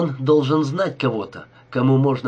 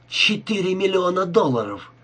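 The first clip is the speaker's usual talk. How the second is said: higher-pitched amazement at a fortune; amazed exclamation; 6 semitones higher than usual. A narrator's reading. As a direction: exclaim it high, marvelling at the sum. Rika reacts to the huge sum. (изумлённое восклицание, повыше)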